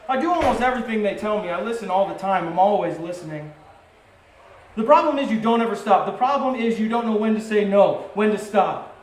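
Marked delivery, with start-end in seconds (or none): 4.7-9.0 louder, higher scolding mom, pressing each point; frustrated wife nagging her husband, raised voice. Retold or imitated. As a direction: scold him like a fed-up wife. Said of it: "And this is my mom".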